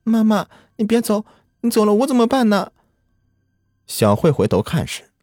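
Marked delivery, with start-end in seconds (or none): 0.0-2.7 put-on high, pleading child's voice; a small girl begging her mother to stay; little girl crying out. A narrator's reading. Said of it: "灵儿喊道".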